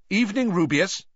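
curt, sharp greeting. A narrator's reading. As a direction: clip the greeting coldly. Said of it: "said Riddle sharply".

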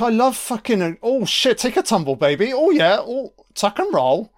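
high, loud hammed-up taunting; mocking Carter. Viewer mocks Carter taking a tumble, high loud hammed-up glee. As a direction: squeal it out like he's loving the beating. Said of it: "Carter's like".

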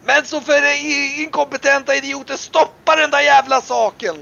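high, loud exasperated yelling; angry shouting. Acted. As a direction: yell furiously at incompetent underlings. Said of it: "Du hör även hur Abbas liksom ropar".

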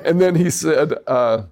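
normal talking voice

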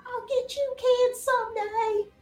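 high, slow villain shaking a fist; sputtering foiled villain. Acted. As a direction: spit out a foiled threat. GM voices Hoki shaking fist.

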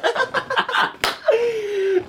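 high burst of laughter. Real, not acted.

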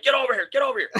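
high-pitched mock yell at a dog; retold hollering without full volume; imitated scream. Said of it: "I'm screaming at this dog".

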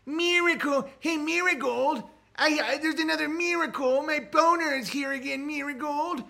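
high, odd comic voice calling to Marigold; high-pitched comedic caller; silly character voice. Pretend. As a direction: play a goofy man shouting to Marigold. Mock character scene.

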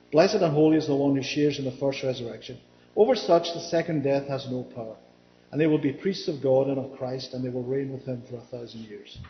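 low, level reading voice; scripture reading. Acted. Preacher reads a verse to the congregation, low measured recital. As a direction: read the verse out evenly, pitched well down.

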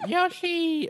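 very high, loud mascot squeal; Yoshi. Acted. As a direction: pitch it way up like a cartoon creature. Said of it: "it's too low pitch".

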